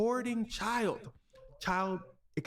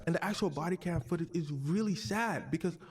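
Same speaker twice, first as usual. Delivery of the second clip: usual speaking voice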